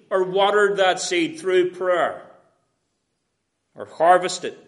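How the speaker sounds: loud, ringing pulpit delivery, words stretched out; preaching at full force. Acted. Preacher rousing his congregation about Paul's joy, full force. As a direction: preach it out to the back pews.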